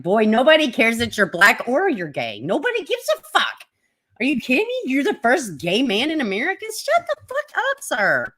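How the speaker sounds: high mock scolding; scolding retort. Streamer imagining scolding Smollett, in a high mock scold. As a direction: tell him off in a squeaky scold. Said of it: "I was like".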